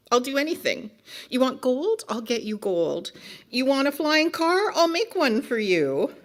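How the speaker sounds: high, eager pleading offers; desperate bargaining. Acted. Book character offering gold to the reader, desperate. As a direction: lift each offer into a hopeful question.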